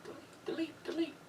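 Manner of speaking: high, whispered taunting chant of delete; mocking high whisper. Teasing chant of the mod's threat.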